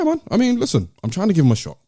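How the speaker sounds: normal talking voice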